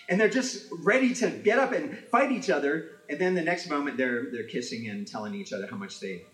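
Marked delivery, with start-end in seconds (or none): none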